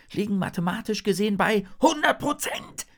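high, sharp interruption; Hannah, hissing. A narrator's reading. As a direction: cut in sharply, pitched high. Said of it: "zischt Hannah". (scharf gezischt, mit hoher Stimme)

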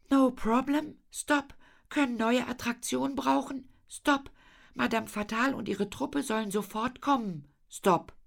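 high, level-pitched telegram voice; reading out a telegram. Acted. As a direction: recite it high, every stop set apart. Lina reads out the telegrams. (hohe, monotone Telegrammstimme)

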